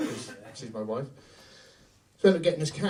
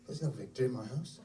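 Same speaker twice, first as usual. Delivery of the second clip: normal talking voice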